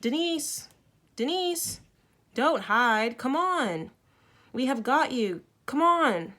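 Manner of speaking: loud high calls across the hillside; calling out. A narrator's reading. Children search for hiding Denise, calling out loudly. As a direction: shout to find someone hidden. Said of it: "Then we started to call".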